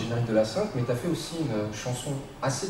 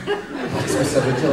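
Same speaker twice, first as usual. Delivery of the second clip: laughing question; 3 semitones higher, 6 dB louder, slower than usual. Real, not acted. Baffled by odd studio request.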